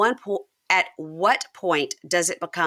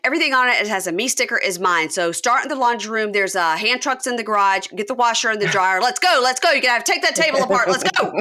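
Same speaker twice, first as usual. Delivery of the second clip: higher, louder and fast, barking out moving orders; bossy orders; 5 semitones higher, 4 dB louder than usual. Retold or imitated. Amber bossing the friends to move everything out, loud fast orders. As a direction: hurry the crew along like a foreman.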